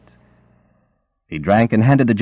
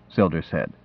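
plain reading voice

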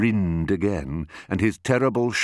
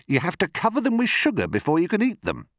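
high, quick complaint; fussy high crocodile voice; peevish not-so-big crocodile; 13 semitones higher, faster than usual. Acted. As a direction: rattle it off in distaste.

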